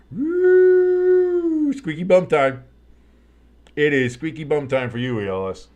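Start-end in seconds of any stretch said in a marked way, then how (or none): 0.1-1.7 sudden high whoop of glee; excited whoop. Real, not acted. Teasing a chat viewer about his fantasy team, high excited whoop. 1.7-5.2 loud, gleeful ribbing; gleeful teasing. Real, not acted. Ribbing a chat viewer whose fantasy lead shrinks, loud and gleeful.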